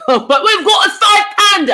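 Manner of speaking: laughing, then shouted high and loud; excited shout. Real, not acted. Streamer shouting a five pound donation to viewers, high and loud. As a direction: shout the news to the chat.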